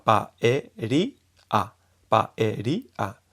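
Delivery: paeria slow, i and a apart; paeria without diphthong. Shown as a demonstration. Teacher showing four-kana paeria. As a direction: split paeria into clear syllables. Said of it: "sin diptongo".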